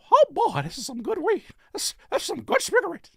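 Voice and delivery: very high cartoon falsetto; squeaky helium mouse; Mickey Mouse. Host as Disney smoking, squeaky mouse voice. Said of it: "do that voice".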